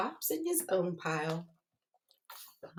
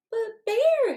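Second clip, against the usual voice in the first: much higher, innocent "But Bear"; sweet protest; 13 semitones higher, 4 dB louder than usual. A narrator's reading. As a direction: play the innocent trickster.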